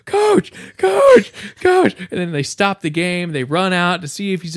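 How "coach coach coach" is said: high, loud cries for the coach; hammed-up shouting, pitched way up; panicked yelling. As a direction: yell for help like a downed player. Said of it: "he starts yelling".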